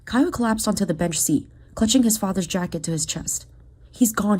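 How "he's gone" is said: plain reading voice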